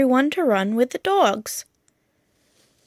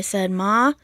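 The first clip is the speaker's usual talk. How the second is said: plain reading voice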